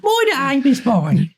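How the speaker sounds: high, exaggerated Brummie parody; Brummie accent. Teasing friend echoing Mike's line, hammed-up Brummie accent. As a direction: push the Brummie up high and broad. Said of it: "do your Brummie accent".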